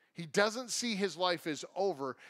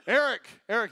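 loud, higher angry call; ticked-off mom; 4 semitones higher, 9 dB louder, faster than usual. Retold or imitated. Mom calling her hiding son, loud and ticked off. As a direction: imitate a fed-up mother shouting a name. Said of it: "now she's ticked".

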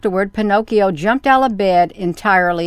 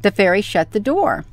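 plain reading voice

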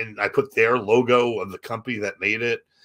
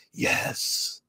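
soft, voiceless whispered answer; ghostly whisper; 6 dB quieter than usual. Retold or imitated. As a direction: imitate a spirit caught on tape. Imitates ghost voice on recording.

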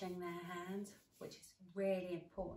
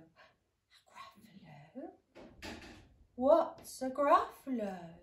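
slow puzzled owl; 6 semitones higher, 11 dB louder, slower than usual. Acted. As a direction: draw it out puzzled.

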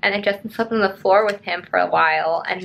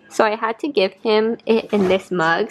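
ordinary talk, nothing marked

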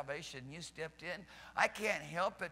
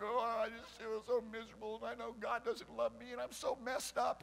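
mock miserable whine; 2 semitones higher than usual. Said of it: "You're like".

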